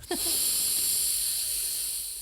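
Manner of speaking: long exaggerated hugger's breath; heavy breathing. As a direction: imitate a drawn-out, airy breath during a hug. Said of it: "Oh, the breathing".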